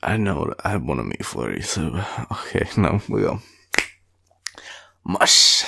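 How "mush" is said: mock sled command. Urging boyfriend to go.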